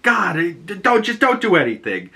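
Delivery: high, stammering imitated irritation; mock frazzled boss; flustered anger. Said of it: "he gets all pissed".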